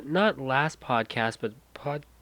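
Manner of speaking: usual speaking voice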